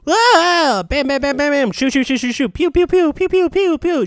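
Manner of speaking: loud, high imitated gunfire; shouting shooting sound effects; gun noises. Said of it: "and she's not like".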